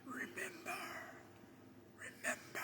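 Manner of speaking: soft, ghostly, lightly voiced Mufasa; faint ghostly whisper of Mufasa. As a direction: echo it like a ghost king from the clouds. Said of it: "there's Mufasa".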